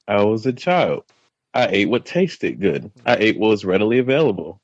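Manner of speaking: usual speaking voice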